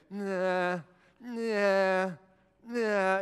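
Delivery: drawn-out whiny refusal; mock-whiny, reluctant moan; Moses. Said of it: "hear him in this whiny voice".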